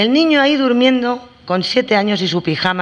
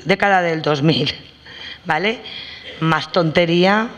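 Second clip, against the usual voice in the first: ordinary talk, nothing marked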